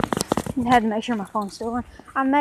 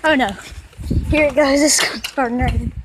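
ordinary talk

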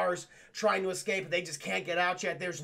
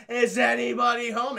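louder sing-song call; 4 semitones higher, 7 dB louder than usual. Retold or imitated. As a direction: call out into the empty house. Villains calling through the house.

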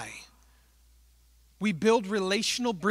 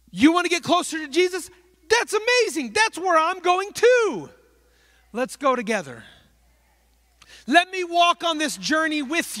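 higher, louder, eager welcome; eager invitation; 9 semitones higher, 5 dB louder than usual. Retold or imitated. Believer to a seeker, eager invitation to walk together. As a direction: call them along warmly and gladly. Inviting friend along journey.

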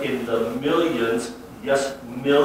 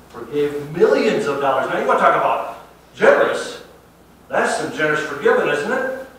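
preaching; 6 semitones higher, 9 dB louder than usual. Acted. Pastor marvels at the king's mercy.

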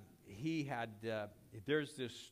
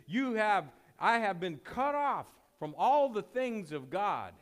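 impassioned preaching; 7 semitones higher, 10 dB louder than usual. Acted. Preacher paraphrasing David loudly.

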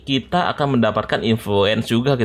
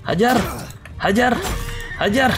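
excited cheering; 11 semitones higher than usual. Real, not acted. Cheering on his troops in battle.

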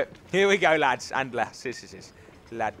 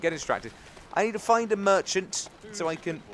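ordinary delivery

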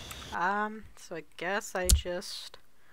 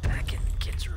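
whispering while hiding. Real, not acted. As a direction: gasp then hushed whisper. Hiding from homeowner.

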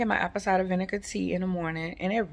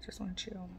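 whisper; 13 dB quieter than usual. Real, not acted. Repeats line, second time whispered.

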